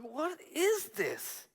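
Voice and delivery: high, slow, soft question; exasperated disbelief. Retold or imitated. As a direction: draw it out in bewilderment. Retelling his exasperation with God.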